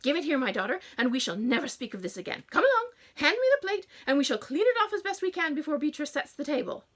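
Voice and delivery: mother scolding Emma over the plate. A narrator's reading. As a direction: wind yourself up into a rant. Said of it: "winding herself up again".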